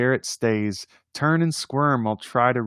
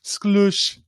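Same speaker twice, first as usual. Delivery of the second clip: sklush; 8 semitones higher than usual. Acted. Poem's final squish word.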